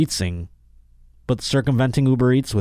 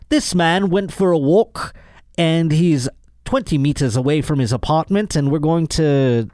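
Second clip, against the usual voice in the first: a shaky, hammed-up attempt at an Australian accent, pitched higher; mock Australian cop in a rough Australian accent, higher; 6 semitones higher than usual. Host mocking Australian police with a clumsy Australian accent. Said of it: "that was an attempt at an Australian accent".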